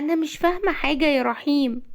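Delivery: high-pitched, worn-out worried woman; mildly frightened female character voice; fearful and tired. A narrator's reading. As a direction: plead confusion in a high, weary voice. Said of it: "رزان بخوف وتعب".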